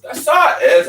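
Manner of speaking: sharp high loud snarl. A narrator's reading. Narrator snarling at the gun, pitched high and loud. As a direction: spit it out in anger. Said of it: "I snarled at the gun".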